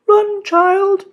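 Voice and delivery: high, clear spirit voice; urging. A narrator's reading. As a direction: urge her gently. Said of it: "urged the Spirit of the Rainbow".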